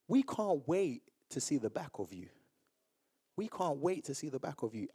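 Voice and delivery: low, soft, deliberate delivery; cold staff member. Retold or imitated. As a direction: quote a hurtful dismissal quietly. Staff member's cold remark, retold.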